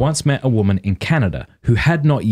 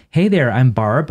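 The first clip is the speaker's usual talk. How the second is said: imitated American woman's greeting; American accent; 3 semitones higher, slower than usual. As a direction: introduce yourself with an American accent, slowly. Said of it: "do it in American accent".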